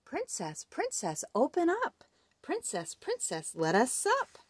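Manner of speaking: sing-song frog call; frog calling at door. Acted. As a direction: call through the door in a lilt. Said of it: "Someone called".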